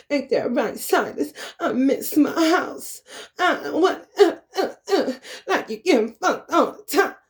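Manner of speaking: high, halting mock voice with little laughs; mocking imitation. Mocking how the other woman talks, high taunting impression. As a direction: mimic the rival in a squeaky, broken-up whine. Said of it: "You talk like this".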